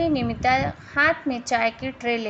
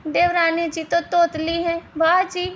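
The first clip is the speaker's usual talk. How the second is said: higher-pitched, amused jeer; laughing mockery; 5 semitones higher, 3 dB louder than usual. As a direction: tease her and break into a laugh. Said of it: "ज़ोर ज़ोर से हंसने लगती हैं". (हँसते हुए मज़ाक उड़ाना)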